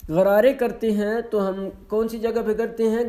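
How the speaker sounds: usual speaking voice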